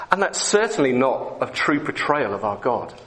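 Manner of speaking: ordinary delivery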